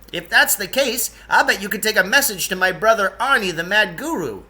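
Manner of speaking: high-pitched eager guide; Lance Hergesheimer voice. Acted. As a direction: pitch him up, quick and keen. Character voice of Lance.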